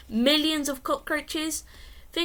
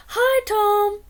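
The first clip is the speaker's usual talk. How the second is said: higher voice for Mark; cheerful higher boy's greeting; friend's voice; 9 semitones higher, 7 dB louder than usual. A narrator's reading. Mark arrives at the door.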